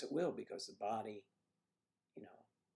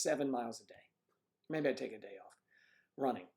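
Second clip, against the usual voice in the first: normal talking voice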